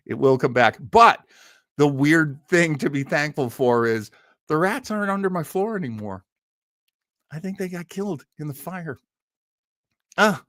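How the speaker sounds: ordinary delivery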